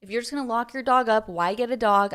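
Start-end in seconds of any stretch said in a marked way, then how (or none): none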